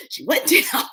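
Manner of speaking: high, laughing words; real amusement bubbling through. Real, not acted. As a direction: break up laughing mid-sentence. Laughing at her own dream fight.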